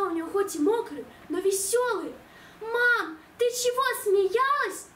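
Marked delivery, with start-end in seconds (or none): 2.6-4.9 high, slow offended whine; a hurt little girl's complaint; offended child. Acted. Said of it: "обиделась я".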